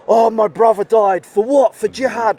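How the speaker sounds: mock ranting. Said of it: "he started just ranting".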